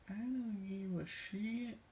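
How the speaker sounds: slow put-on impression of a friend; imitating friend's catchphrase. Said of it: "catchphrases he would say".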